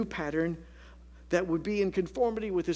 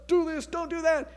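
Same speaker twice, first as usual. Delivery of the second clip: preachy command; 13 semitones higher, 6 dB louder than usual. Retold or imitated. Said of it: "churches to tell us".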